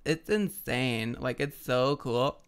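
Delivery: put-on slow, stretched-out Valley Girl gush; Valley Girl accent. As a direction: drag the vowels like a Valley Girl. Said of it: "The Valley Girl accent".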